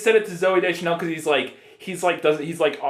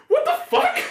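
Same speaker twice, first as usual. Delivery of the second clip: much higher, stunned what the fuck; shocked high disbelief. Retold or imitated. Reacting to the dad's outburst, shocked high squeal. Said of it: "I was like".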